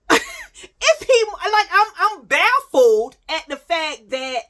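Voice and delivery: high, loud laugh breaking into words; laughing at her own story. Real, not acted. Telling her followers the story, laughing high and loud.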